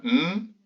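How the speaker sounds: "m" held as a long hum; son m isolé. Shown as a demonstration. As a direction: sound out "m" alone, not the letter name. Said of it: "et quatre sons". (son « m » tenu, bouche fermée)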